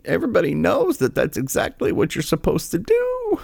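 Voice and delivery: high, whiny falsetto of mock distress; mock weepy liberal. Host mocking the spokeswoman's tears, whiny falsetto. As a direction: ham up fake outrage in a squeaky voice.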